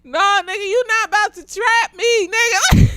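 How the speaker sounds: high, defiant no; mock-furious defiance; defiant refusal. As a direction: refuse to be trapped. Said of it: "how I feel about people trying to control me".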